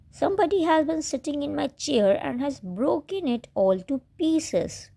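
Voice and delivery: high, tearful cub over his broken chair; sobbing baby bear. A narrator's reading. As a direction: sob it out like a heartbroken cub. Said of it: "he sobbed".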